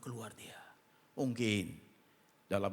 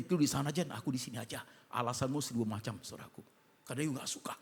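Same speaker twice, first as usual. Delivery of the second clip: ordinary delivery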